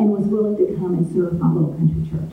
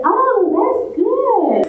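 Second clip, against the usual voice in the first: put-on kindly old pastor; 13 semitones higher, 3 dB louder, much slower than usual. Old pastor answers with big smile.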